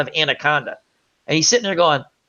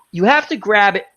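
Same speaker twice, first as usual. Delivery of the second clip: ordinary talk, nothing marked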